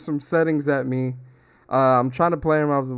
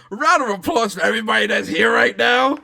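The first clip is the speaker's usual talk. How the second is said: loud, high host's applause call; hype-man shout for applause; rousing shout; 8 semitones higher, 7 dB louder than usual. Real, not acted. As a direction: holler it out to the whole crowd. Redoes applause call as big announcement.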